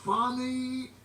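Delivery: high, slow, giggly call; giggling zookeeper. A narrator's reading. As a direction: giggle the name out. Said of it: "giggled the zookeeper".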